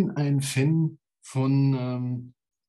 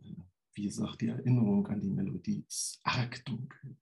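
ordinary delivery

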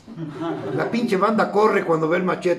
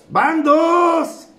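high, raised warning count; called-out warning; 6 semitones higher, 4 dB louder than usual. Retold or imitated. Drinker counts the second insult, called-out warning. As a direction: sing out the tally as a threat.

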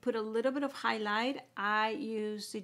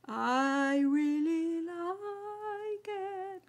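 very slow, much higher, savouring really; admiring drawl; 7 semitones higher, much slower than usual. Real, not acted. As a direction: stretch it out, admiring the look. Admiring finished eye look.

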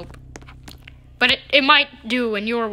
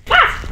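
very high short loud scream; short high shriek; startled scream; 6 dB louder than usual. Real, not acted. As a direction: yelp in fright. Startled by gunfire.

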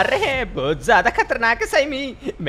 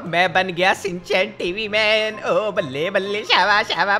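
usual speaking voice

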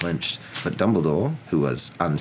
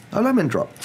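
high, louder character voice; old wizard; 5 dB louder than usual. Acted. Wizard repeats his offer of a sweet, high and pleasant. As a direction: explain it brightly, pitched right up.

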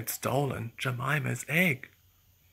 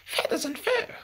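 high squawking duck voice, much higher. Acted. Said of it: "squawked Jemima".

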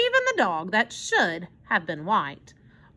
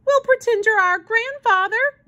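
high and a little louder, eager plea; begging; 13 semitones higher, 5 dB louder than usual. A narrator's reading. Susie begging the old man to stay, high and eager. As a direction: coax him like a hopeful child. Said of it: "she begged".

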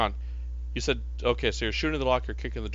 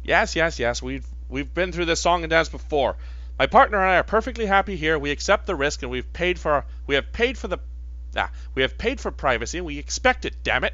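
louder, higher, fast irritated refusal; indignant; 5 semitones higher, 4 dB louder than usual. Acted. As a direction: insist angrily, voice rising. NPC refuses to open door.

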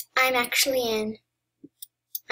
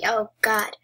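ordinary talk

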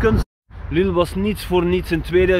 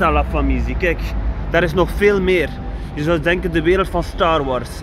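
normal talking voice

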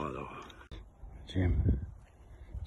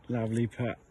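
ordinary delivery

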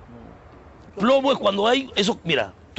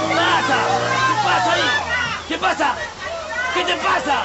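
higher, confrontational yelling; angry challenge; 6 semitones higher than usual. Acted. Driver confronts rival bus mid-race, angry yelling. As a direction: yell a challenge across the traffic.

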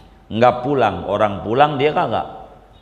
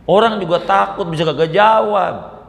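mock scolding; 8 semitones higher than usual.

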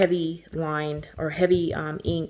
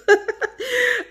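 high giggle; 5 dB louder than usual. Real, not acted. Laughing between thanks.

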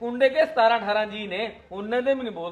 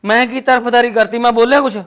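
loud, higher indignant yell; defensive shout; 4 semitones higher, 15 dB louder than usual. Acted. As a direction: shout back in protest. Husband shouts defence at wife. (ਗੁੱਸੇ ਵਿੱਚ ਉੱਚੀ ਆਵਾਜ਼ ਨਾਲ ਸਫ਼ਾਈ)

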